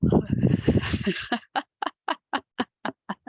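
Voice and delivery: a short embarrassed laugh; breathy laugh. Real, not acted. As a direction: laugh it off lightly. Laughs at her own summary.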